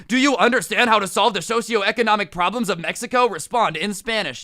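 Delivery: a pompous teacher firing off an absurd question; mock teacher quiz.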